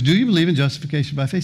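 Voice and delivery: rapid-fire questioning, rising at the end; quick insistent repeat. Retold or imitated. Angel supervisor pressing the baffled thief, fast and insistent.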